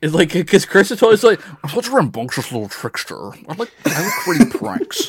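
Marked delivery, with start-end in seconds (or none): 1.6-5.1 a light put-on smug mod voice; Discord mod voice. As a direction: act like a smug Discord moderator. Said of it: "the Discord mod voice".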